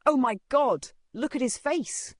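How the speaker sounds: high, horrified exclamation; distressed mother. Acted. Mother strokes her beaten son's head, horrified. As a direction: cry out in alarm.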